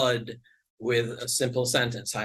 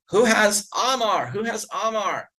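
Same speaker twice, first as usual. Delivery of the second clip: hint of a man calling to passers-by; calling out in a mild mock voice; 9 semitones higher than usual. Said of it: "walk and say to people".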